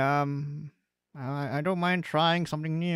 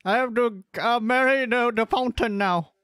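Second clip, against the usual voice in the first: mock dramatic plea; 7 semitones higher than usual. Dramatic farewell, marrying the fountain.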